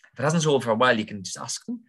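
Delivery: ordinary talk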